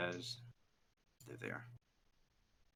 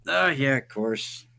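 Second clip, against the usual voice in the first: slow higher voice; 5 semitones higher, 6 dB louder, slower than usual. Acted.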